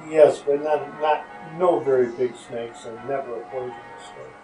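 normal talking voice

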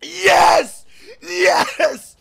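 high, straining celebratory yell; jubilant cry. Real, not acted. Player celebrating the delivery, jubilant cry. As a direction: cheer it high and hard.

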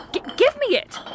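snatch it with your voice; sudden snap. Acted. To the partner sneaking another sip, sharp high demand.

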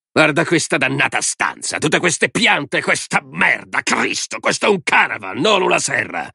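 high, disgusted tirade with stretched words; angry rant. A narrator's reading. Man rails at girl's plant-filled room, angry. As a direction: rant in disgust, leaning on each complaint.

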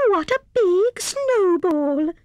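high, drawn-out squirrel exclamation; cried out. A narrator's reading. Squirrel spots an enormous snowball, cried out. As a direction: marvel in a high, slow cry. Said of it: "cried Squirrel".

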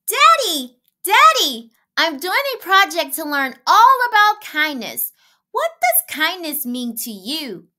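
put-on high, loud little girl calling Daddy; child calling out. A narrator's reading. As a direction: call out eagerly like a small girl.

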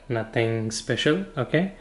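usual speaking voice

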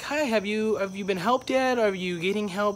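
high-pitched, polite check-in; retold helpful greeting. Worker checking on a little old lady, helpful high voice.

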